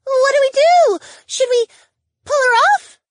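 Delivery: very high, loud frantic questions; panicked squeak. Acted. Valerie asking whether to pull imp woman off, squeaking. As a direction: squeal it in alarm. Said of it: "Valerie squeaked".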